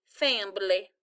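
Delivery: "Fambly", with a b in the middle for family; "Fambly", two beats with an mb. A narrator's reading. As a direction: say family as "Fambly", Southern style. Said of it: "it sounded like she was saying".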